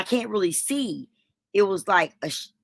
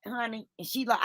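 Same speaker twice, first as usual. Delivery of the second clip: ordinary delivery, nothing marked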